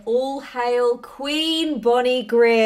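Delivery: slow, loud herald proclamation; grand announcement. Acted. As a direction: announce her like royalty.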